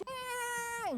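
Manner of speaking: high imitated wail of a scolding; fierce woman ranting. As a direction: mimic her shrieking in one long high wah. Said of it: "paling galak tuh yang perempuannya". (tiruan omelan perempuan galak)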